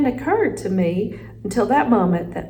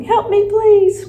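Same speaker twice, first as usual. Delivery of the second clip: loud, high, slow plea; yelling for help; 13 semitones higher, 8 dB louder, slower than usual. A narrator's reading. Hamster trapped under the bag, yelling for help. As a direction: yell a drawn-out squeaky plea. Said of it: "I'm not sure why I was yelling".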